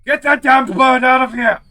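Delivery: high, loud panicked yell; panicked shout. Acted. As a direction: scream it like a bomb is in the room. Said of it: "in a panic ... shouts".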